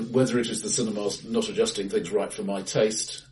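ordinary delivery

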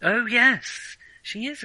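ordinary delivery

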